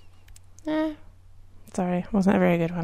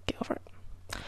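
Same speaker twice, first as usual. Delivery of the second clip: whispering; 12 dB quieter than usual. Real, not acted. Teasing whisper to co-host.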